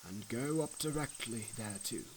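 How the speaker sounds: soft, slow, hushed guiding voice; Evangelist's counsel. A narrator's reading. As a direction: quietly point the pilgrim on his way. Evangelist directs the man.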